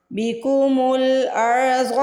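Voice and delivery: बिकुमुल अर्ज़ slow, with a thick full र; heavy ra. Shown as a demonstration. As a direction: round out the र in अर्ज़ heavily. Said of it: "रॉ को मोटा पढ़ना है". (रॉ मोटा पढ़ें)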